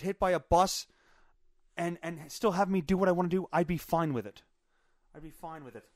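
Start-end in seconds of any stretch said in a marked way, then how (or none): 5.1-5.8 much softer, under the breath; quiet aside. Real, not acted. Admitting he'd accept people hit by bus, quiet aside. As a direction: drop it to a low-volume afterthought.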